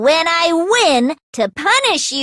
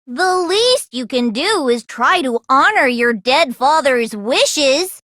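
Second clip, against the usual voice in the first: ordinary talk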